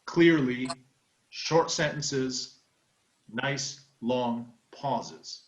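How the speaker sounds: very slow, with long pauses between phrases; language coach delivery. Shown as a demonstration. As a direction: spell it out slowly like a language coach. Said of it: "like a language coach, slowly".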